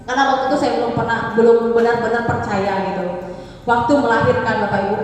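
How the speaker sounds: ordinary talk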